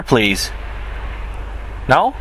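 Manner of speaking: normal talking voice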